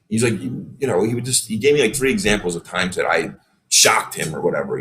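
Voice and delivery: normal talking voice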